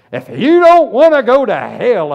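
loud, high hellfire preaching; fire-breathing evangelist. Retold or imitated. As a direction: boom it out like a revival preacher warning of hell. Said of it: "this is how he said it".

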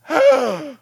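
high, loud shocked "Whoa". Retold or imitated. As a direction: gasp then cry out at the damage. Sees stain on the house.

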